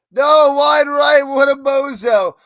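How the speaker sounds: high, loud, slow yell at the missed kick; frustrated shout. Real, not acted. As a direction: blow up at the kicker.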